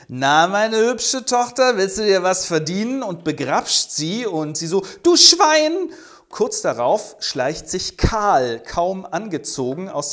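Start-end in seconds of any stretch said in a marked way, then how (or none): 0.1-3.0 a leering, slowed, louder come-on; lecherous drunk. Acted. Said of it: "Und er wird echt widerlich". 5.0-5.9 very high, loud indignant cry; outraged shriek. Acted. Daughter shoving off her groping father, shrieking in outrage.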